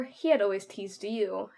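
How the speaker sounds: plain reading voice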